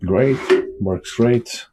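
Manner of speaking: normal talking voice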